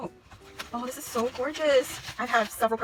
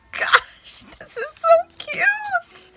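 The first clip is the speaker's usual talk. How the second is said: very high, drawn-out gush, loud; real high-pitched fangirl squeal; excited squeal; 6 dB louder, slower than usual. Real, not acted. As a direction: squeal it slowly, overcome with delight. Gushing over a cute gift.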